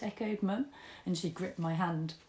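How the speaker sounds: usual speaking voice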